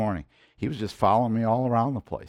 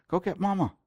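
higher, coaxing voice for the dog; talking to the dog; 7 semitones higher, 4 dB quieter, faster than usual. Real, not acted. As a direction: gently send the dog to find Mama.

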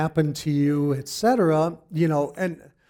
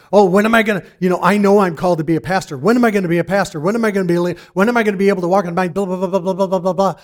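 loud, fast, eager whining; impatient young self; 3 semitones higher, 7 dB louder, much faster than usual. Retold or imitated. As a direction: rattle it off like a restless young man. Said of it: "this young guy".

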